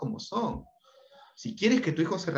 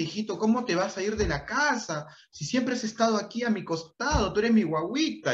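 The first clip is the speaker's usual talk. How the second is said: higher, pleading mother clinging to her son; desperate mother; 6 semitones higher than usual. Retold or imitated. Mother begging her grown son to stay, pleading higher voice. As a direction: beg him not to leave, a touch hammed-up. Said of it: "la mamá entra en desesperación y dice".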